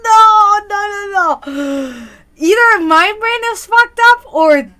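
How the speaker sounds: high burst of laughter into loud no, no; real laughing shout of shock; shocked laughing cry. Real, not acted. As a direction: shriek with laughter then protest in disbelief. Shocked by a viewer's reveal.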